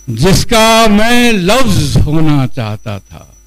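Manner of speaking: measured mushaira recital with drawn-out rhyme words; Urdu poetry recitation. Acted. Poet reciting his ghazal to a gathering, declamatory recitation. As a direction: declaim each line, let it fall away at the end.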